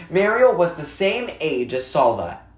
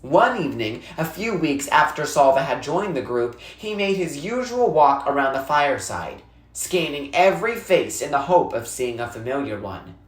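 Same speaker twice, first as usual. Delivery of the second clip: plain reading voice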